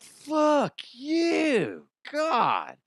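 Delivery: high, strained mock outburst at God; retold curse at God. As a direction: fling it skyward in pain. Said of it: "And I'm like".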